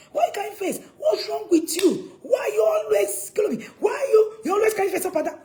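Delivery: high, full-volume angry shouting; acted furious scolding; upset parent. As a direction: rant at a gloomy teenager, piling up questions. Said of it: "You are upset".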